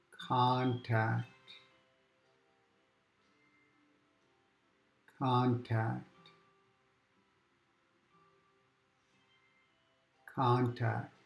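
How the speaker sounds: gently repeated word, unhurried; soft repeated mantra. Shown as a demonstration. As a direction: murmur it like a mantra. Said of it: "you can say to yourself".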